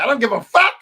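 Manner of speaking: imitating a yeller. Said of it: "We all know this man is a yeller".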